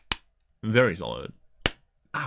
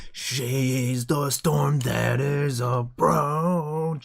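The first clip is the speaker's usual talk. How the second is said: slow, grand trailer voice in an unfamiliar timbre; dramatic announcer impression; 4 semitones higher, much slower than usual. Streamer quoting a famous line to chat, slow and grand. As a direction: intone it slowly like an epic trailer line. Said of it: "That's my best impression".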